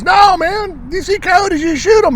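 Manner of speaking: landowner's reply. Retold or imitated. Ranch owner telling the hunter to shoot coyotes, in a high put-on voice.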